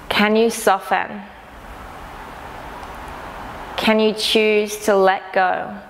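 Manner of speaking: calm, unhurried meditation guide, long pauses between phrases; guided meditation. Acted. Teacher inviting listeners to notice sounds around them, calm guided meditation. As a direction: gently lead them inward, leaving space after each phrase.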